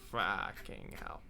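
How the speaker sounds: slow whispered swear; whispered curse. Real, not acted. Reading the standings, whispered curse. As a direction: breathe the curse out.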